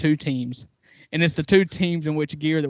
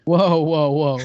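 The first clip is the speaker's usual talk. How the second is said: loud protest; 6 dB louder, slower than usual. Real, not acted. Protests co-host's mic jab.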